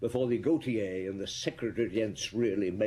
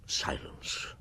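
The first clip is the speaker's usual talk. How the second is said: breathy whisper of a single word; whispered hush; 6 dB quieter than usual. A narrator's reading. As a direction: breathe it, barely audible. Narrator whispers in tense scene.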